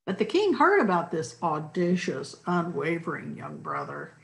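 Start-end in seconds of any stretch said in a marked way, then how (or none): none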